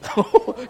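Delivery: sudden high burst of laughter. Real, not acted. Preacher laughing at his own kick, high. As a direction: laugh at the memory. Laughs after kicking bully.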